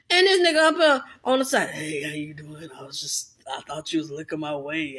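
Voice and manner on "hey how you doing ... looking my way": low, soft put-on man's voice; a sleazy guy trying his luck; imitating a creepy guy. Imitating man hitting on her.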